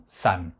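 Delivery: "san" with a sounded final n; Japanese pronunciation. Shown as a demonstration. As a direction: model the Japanese reading "san". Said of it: "nous le prononçons".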